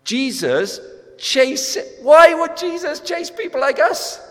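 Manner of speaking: preacher pleading at the top of his voice; impassioned preaching. Real, not acted. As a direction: cry it out to the whole room. Preaching at full force.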